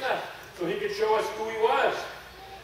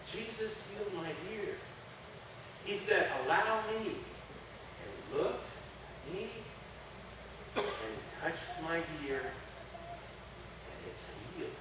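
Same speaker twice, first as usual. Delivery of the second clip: hushed awe; 7 dB quieter than usual. Acted. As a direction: drop to a reverent hush. Awed retelling of the healing.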